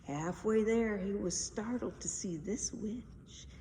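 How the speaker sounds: usual speaking voice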